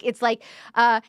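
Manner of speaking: ordinary talk, nothing marked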